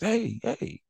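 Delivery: soft, high hey hey; gentle owner call. Retold or imitated. Owner first talking softly to the pulling dog. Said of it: "the owner try to talk to him first".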